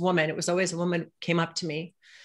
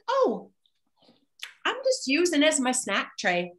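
much higher, breezy matter-of-fact mom; mother's voice; 10 semitones higher than usual. Retold or imitated. Mother explaining her snack tray to her daughter, breezy. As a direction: toss it off like it's nothing.

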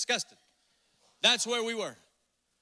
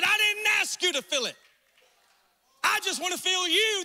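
preaching shout; 7 semitones higher than usual. Acted. God's voice preached aloud.

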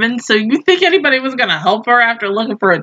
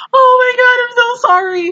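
flustered retold apology; 13 semitones higher, faster than usual. Acts out her flustered apology.

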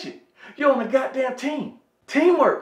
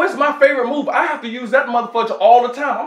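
ordinary delivery

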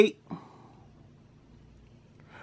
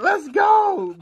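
high, punched-out celebration; excited cheer; 9 semitones higher than usual. Real, not acted. Scratcher celebrating another win, excited high cheer.

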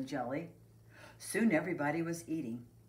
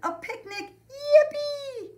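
high squealing cheer, building louder; excited shouting; 9 dB louder, slower than usual. A narrator's reading. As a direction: cheer like a thrilled kid. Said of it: "everyone shouted".

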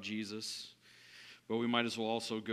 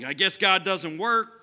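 mock exasperation; 7 semitones higher, 11 dB louder than usual. Giving up on God.

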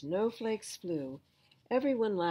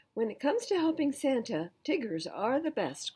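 higher, louder bouncy boast; Tigger boasting; 6 semitones higher, 5 dB louder than usual. Acted. Tigger boasting to friends, higher and louder. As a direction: brag it with bounce. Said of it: "cried Tigger".

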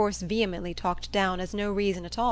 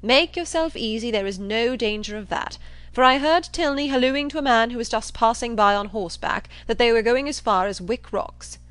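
loud, confident dismissal; blustering John Thorpe; 3 semitones higher, 6 dB louder than usual. Acted. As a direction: brush the worry aside forcefully. Thorpe brushes off her worry.

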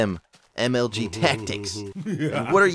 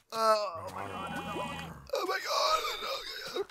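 a little excited; 9 semitones higher, 11 dB quieter than usual. Real, not acted. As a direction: squeal it softly, carried away. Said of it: "I got a little excited there".

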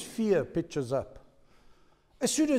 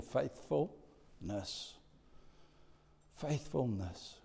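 soft emphasis; 4 semitones lower, 13 dB quieter, much slower than usual. Real, not acted. Preacher slows on key word.

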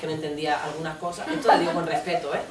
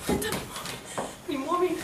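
tiny, very high, panicky voice; small high fearful murmur; paralysed by fear; 10 semitones higher, 6 dB quieter, faster than usual. Acted. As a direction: squeak it out, frightened and searching. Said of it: "El miedo te paraliza".

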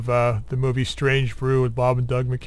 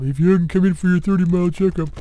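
movie character voice; 4 semitones higher, 4 dB louder than usual. Retold or imitated. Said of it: "he's got him hooked up".

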